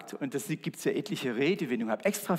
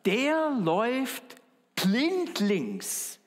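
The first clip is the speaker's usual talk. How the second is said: much higher, very slow on blindlings; drawn-out saying; 10 semitones higher, 4 dB louder, much slower than usual. Shown as a demonstration. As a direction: stretch out the word blindlings. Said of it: "Noch ein Ausspruch". (sehr langsam und deutlich höher, „blindlings“ gedehnt)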